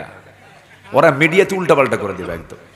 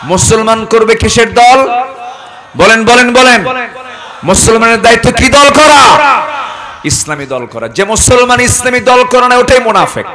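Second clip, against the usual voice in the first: loud, high, slowing preacher's shout; preacher hammering হারাম at the congregation; fiery preaching; 8 semitones higher, 8 dB louder than usual. Acted. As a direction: thunder it at the crowd. Fiery sermon to a crowd.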